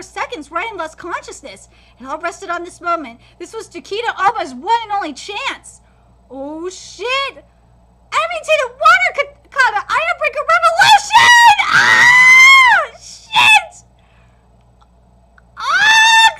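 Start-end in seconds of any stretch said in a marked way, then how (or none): 6.3-16.3 very high, very loud screaming attack; climactic battle scream. Acted. Fighter's final strike, fan screaming along. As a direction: scream the final move at full force.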